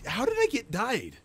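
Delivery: high and loud, caught off guard; startled outburst. Acted. Indignant shocked cry. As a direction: gamer yells at sudden death in mock broken English. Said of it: "I meant to say it that way".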